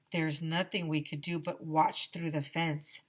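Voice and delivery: plain reading voice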